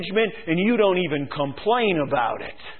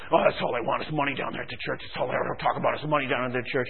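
lower, softer, fast muttered gripe; grumbling churchgoer; 8 semitones lower, 8 dB quieter, faster than usual. Retold or imitated. Churchgoer complaining about money, low and quick. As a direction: mumble it like a sour pew-sitter. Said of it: "When somebody talks about".

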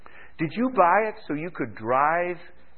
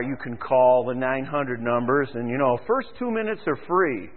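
usual speaking voice

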